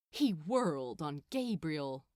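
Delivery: plain reading voice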